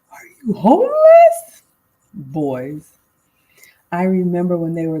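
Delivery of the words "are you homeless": high, loud mock outrage; shocked mom yell. Mom shrieking at her naked wrestling boys, high and loud. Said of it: "I'm like".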